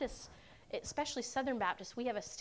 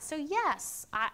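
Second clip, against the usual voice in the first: ordinary talk, nothing marked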